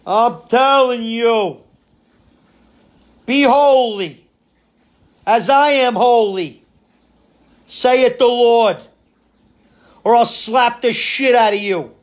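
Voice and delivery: mafia preacher. Retold or imitated. Pastor scaring his congregation, slow booming mafia-boss sermon. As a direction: preach like a mob boss laying down the law. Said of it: "that's how he talked".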